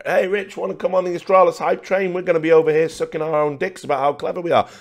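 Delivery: high, mocking voice of smug fans; mocking hype fans. Mocking the Astralis fans who invited him, high and smug. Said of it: "I never got on the Astralis hype train".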